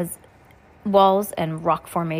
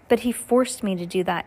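usual speaking voice

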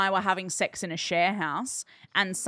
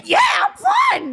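very high, loud strained croak; strangled fine; 7 dB louder, slower than usual. Retold or imitated. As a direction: squeak it out while choking. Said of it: "he's like".

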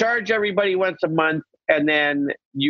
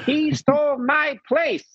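homeless woman voice; 11 semitones higher than usual. Retold or imitated. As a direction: rant like the Chinatown yeller. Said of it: "She's like".

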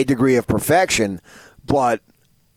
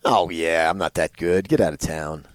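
scoffing; 10 semitones lower, 5 dB quieter than usual. Real, not acted. Scoffing at reaching scratch golf.

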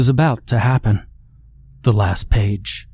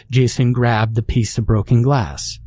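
ordinary delivery, nothing marked

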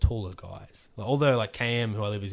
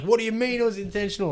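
alarmed nurse; 10 semitones higher, 4 dB louder, faster than usual. Retold or imitated. Nurse freaks out.

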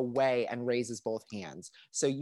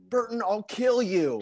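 slow high mock threat; 6 semitones higher, 4 dB louder than usual.

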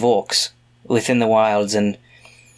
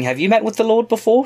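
anxious rise; 11 semitones higher than usual. Acted. Nervous question to father.

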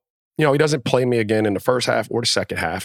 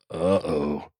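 soft, lower, hushed uh-oh; 5 semitones lower, 6 dB quieter than usual. Retold or imitated. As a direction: breathe it out like a room going silent. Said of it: "the whole locker room looked around like".

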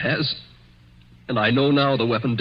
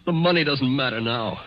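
ordinary talk

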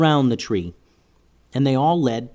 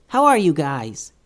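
tiny squeaky critter; 4 semitones higher than usual. Acted. Mouse character voice.

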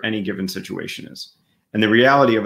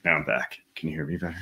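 distant off-mic voice; far from the mic; 5 semitones lower, 7 dB quieter, faster than usual. Real, not acted. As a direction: speak from away from the microphone. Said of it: "leaning back far away from a microphone".

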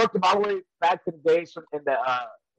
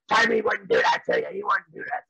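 wife voice. Retold or imitated. Wife answers, voiced low.

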